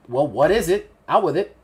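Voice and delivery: lightly voiced brusque wife; impatient wife. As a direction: press a visitor to get to the point. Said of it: "the wife actually turns to you and goes".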